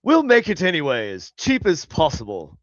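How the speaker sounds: hammy British caricature; mock British accent. Mocking British makers of a cheap show, British accent. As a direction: play a penny-pinching Brit. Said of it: "British people are like".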